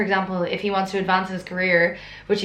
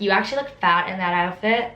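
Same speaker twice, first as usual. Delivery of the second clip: mock American accent for a mean schoolgirl; a catty American mean-girl accent, lightly done. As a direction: put on a snide American teen accent. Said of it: "Why am I doing an American accent?".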